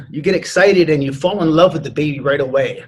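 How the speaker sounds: ordinary talk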